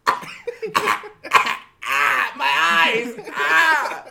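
high, loud choking yelps; mock agony, yelling as if eyes burn; shrieking maced victim, hammed up; panicked scream. Acts out classmate hit by mace.

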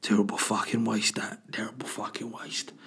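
soft, whispery put-on bird voice; muttering bird. Birds flying over roadkill, whispery muttered disapproval. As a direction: mutter regretfully, half whispered. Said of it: "birds would be flying over being like".

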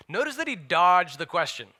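ordinary talk, nothing marked

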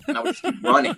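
high laughing burst mid-sentence. Real, not acted. Laughing as he tells the host about running off. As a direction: crack up while telling it. Laughing while recalling escape.

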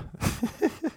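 airy giggle; high breathy laugh. Real, not acted. Laughs at own joke.

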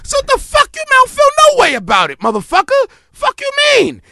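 high, loud outraged shouting; indignant rant. Retold or imitated. Ranting at people who went after a fronting rapper, loud outrage. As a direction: blow up at them in disbelief.

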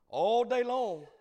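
loud, high, drawn-out cry; impassioned preacher's cry. Real, not acted. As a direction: belt it out at the peak of the story. Repeats the phrase at sermon climax.

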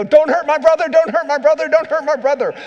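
loud, high protective yell; hollered warning from the older brother to the defense; big brother yelling. Retold or imitated. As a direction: shout it like a big brother shielding his kid brother on the field. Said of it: "he would run up and go".

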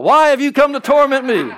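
very high, very loud mock scream; playful scream of a possessed congregation. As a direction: shriek it like a whole room of demons. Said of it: "the whole congregation screams out".

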